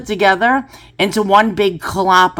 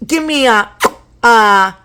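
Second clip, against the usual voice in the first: lip-smacking customer; 2 semitones higher, slower than usual. Retold or imitated. Mimicking New York deli customers ordering, lip-smacking and demanding. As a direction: imitate a customer smacking their lips while ordering. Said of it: "they'd be smacking their lips".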